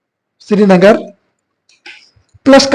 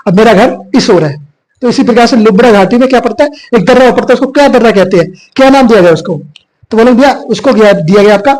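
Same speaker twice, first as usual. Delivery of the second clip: ordinary delivery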